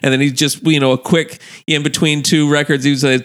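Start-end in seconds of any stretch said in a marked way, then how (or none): none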